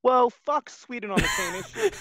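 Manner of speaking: high, loud, slow mock-indignant rant; hammed-up indignation at Sweden; a jokey outraged declaration; mock outrage.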